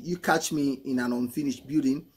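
ordinary delivery

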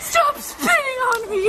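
high, slow, laughing shriek. Real, not acted. Cinnamon spit, shrieking.

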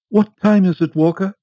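high, loud, fretful lady's voice; a nervous wife calling to her butler; anxious Mrs Foster. A narrator's reading. Anxious wife asks the butler the time, high and fretful.

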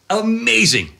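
sudden high, awed "Amazing"; awed exclamation. Real, not acted. Awe at the grizzly standing.

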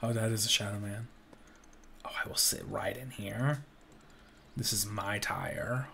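ordinary talk, nothing marked

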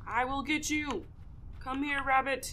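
playfully threatening the rabbit while hunting; mock-menacing hunter. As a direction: growl at the prey like a hammed-up predator. Chasing the rabbit.

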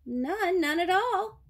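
high, loud, indignant denial; iguana's cry. A narrator's reading. Accused iguana answers King Lion, high crying denial. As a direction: cry out your innocence, pitched right up. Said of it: "cried the iguana".

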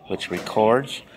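ordinary delivery

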